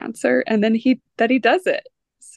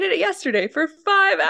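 laughing as she retells; laughing through quote; 10 semitones higher, 3 dB louder than usual. Real, not acted. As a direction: quote broken by her own laugh. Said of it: "he's like".

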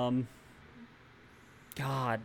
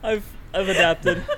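laughing through pain; 6 semitones higher, slower than usual. Real, not acted. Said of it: "Post-surgery laughing hurts".

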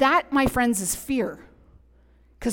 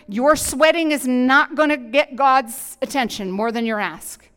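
bold preached prayer; 4 semitones higher, 8 dB louder than usual. Acted. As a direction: declare it boldly to God.

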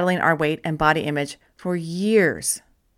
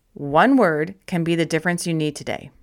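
ordinary talk, nothing marked

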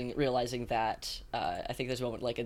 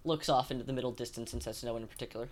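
ordinary delivery

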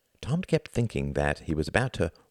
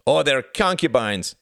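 heckling cry; 8 semitones higher, 5 dB louder than usual. Acted. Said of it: "cried another".

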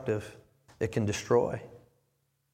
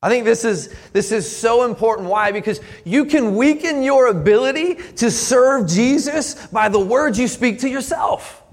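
higher, louder, driving pulpit delivery; preaching at full force; 9 semitones higher, 9 dB louder than usual. Acted. Pastor urging the congregation to examine their self-talk, preaching at full force. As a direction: lean in and press the point hard to the congregation.

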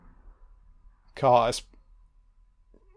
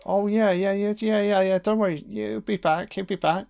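brushing-off tenant voice; 7 semitones higher than usual. Retold or imitated. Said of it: "I know I just said".

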